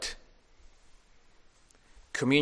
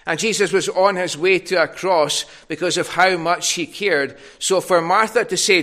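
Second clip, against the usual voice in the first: louder, driving sermon build; preaching; 5 semitones higher, 7 dB louder than usual. Acted. Preacher to congregation, rising impassioned preaching. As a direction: press the point home to the congregation.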